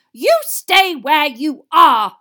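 slow, high, very loud command; snarled order. A narrator's reading. Mother stops her son leaving, angry snarl. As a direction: snarl it word by word. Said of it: "snarled Missus Weasley".

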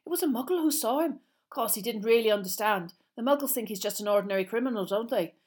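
high, loud, excited schoolboy chatter; Seamus. Acted. Seamus tells of the Muggle who saw him, excited. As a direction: tumble out the gossip excitedly. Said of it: "who looked excited".

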